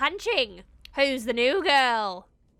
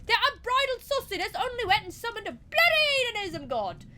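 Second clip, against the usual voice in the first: higher Australian yarn-spinner; lightly voiced Australian; 7 semitones higher than usual. Eddie explaining void shamans to the witch, light Australian accent. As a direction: spin the legend like a cheeky Australian bloke.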